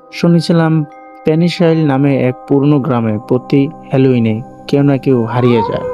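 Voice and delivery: ordinary delivery, nothing marked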